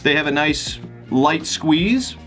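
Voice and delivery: normal talking voice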